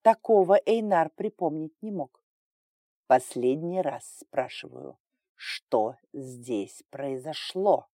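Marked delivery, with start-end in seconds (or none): 3.1-7.9 low, very slow threat; menacing hiss. A narrator's reading. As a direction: draw each word out as a final warning. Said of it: "угрожающе прошипел повелитель". (угрожающе, низко и медленно, сквозь шипение)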